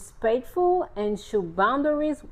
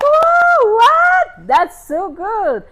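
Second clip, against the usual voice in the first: very high, loud squeal of delight, easing down; squeal, then slow down on the praise; thrilled, over-the-top congratulation; delighted amazement; 13 semitones higher, 11 dB louder, much slower than usual. Retold or imitated. Reacting to news of marriage.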